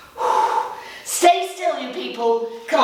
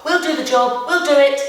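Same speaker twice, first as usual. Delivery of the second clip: keen, pushy little helper voice; eager muscles voice; 6 semitones higher, 5 dB louder than usual. Acted. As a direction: voice the muscles butting in, louder. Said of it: "so they're gonna go".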